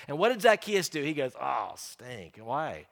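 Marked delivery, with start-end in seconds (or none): 1.4-2.9 grudging reluctance. Pretend. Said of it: "he didn't respond that way at all".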